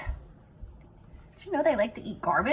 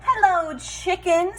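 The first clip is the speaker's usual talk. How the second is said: louder, higher hello to the chicken; loud high duck greeting; 9 semitones higher, 6 dB louder than usual. Acted. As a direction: call it out high and bright.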